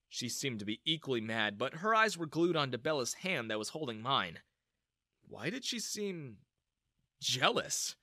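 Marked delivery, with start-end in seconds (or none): none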